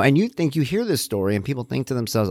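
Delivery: ordinary delivery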